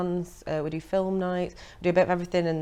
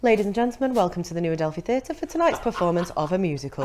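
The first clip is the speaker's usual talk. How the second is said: posh announcer voice; 4 dB louder than usual. Shown as a demonstration. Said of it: "I put my posh voice on".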